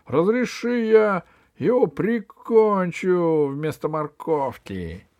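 high, loud, slow eager plea; bloodthirsty wolf. Acted. Wolf asks boss to kill the gnome, loud eager howl. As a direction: beg loudly to finish him off.

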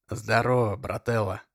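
put-on raspy drunk greeting; hoarse voice. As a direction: croak it out hoarsely. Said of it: "Хриплым голосом".

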